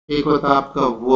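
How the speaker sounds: usual speaking voice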